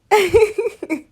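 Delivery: big burst of laughter; loud laugh. Real, not acted. Laughing with Katie.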